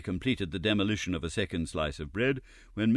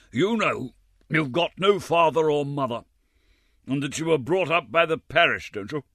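higher, loud official voice; pompous chairman; 7 semitones higher, 5 dB louder than usual. A narrator's reading. Chairman reminding Oliver he is an orphan, pompous. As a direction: press the orphan with a question.

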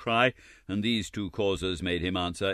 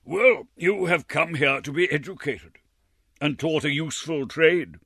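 higher, self-satisfied official; lightly voiced puffed-up gentleman; pompous chairman; 7 semitones higher, 5 dB louder than usual. A narrator's reading. Red-faced chairman telling Oliver his future, pompous.

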